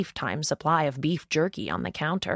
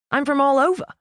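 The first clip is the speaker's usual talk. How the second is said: put-on higher, louder Australian-accented line; Australian twang; 9 semitones higher, 7 dB louder than usual. A narrator's reading. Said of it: "with an Australian twang".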